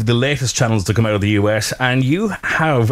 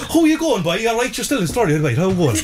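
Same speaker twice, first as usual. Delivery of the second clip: put-on high, bouncy southern Irish accent, like too much Red Bull; a hyper Cork lad in southern Irish accent; excited southern Irish accent; 6 semitones higher than usual. Host imitates an excited southern Irish man chatting, high southern Irish accent. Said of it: "when you go down south, everybody gets really excited".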